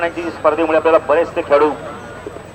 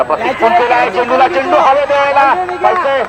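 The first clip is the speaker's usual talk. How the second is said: excited call; 8 semitones higher than usual. Real, not acted. Commentator calling a skied ball and catch, thrilled. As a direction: hold the breath on the catch.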